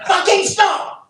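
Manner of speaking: high squealed curse; mock outrage. Comic cursing the winter, high-pitched exasperation.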